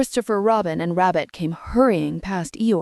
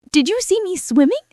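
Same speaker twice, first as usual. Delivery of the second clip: put-on much higher, louder excited child; excited squeak; 12 semitones higher, 5 dB louder than usual. A narrator's reading. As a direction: squeal it out, thrilled with himself. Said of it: "squeaked Roo excitedly".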